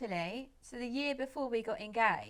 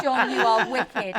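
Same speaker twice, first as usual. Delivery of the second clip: laughing mock scolding; 8 dB louder than usual. Retells mum's scolding, laughing.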